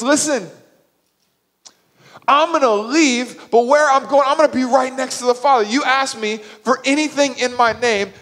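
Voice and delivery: high, loud, impassioned insistence; urgent, preaching-style assurance; emphatic promise. Retold or imitated. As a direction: press the promise home with full force. Jesus insistently explains, acted.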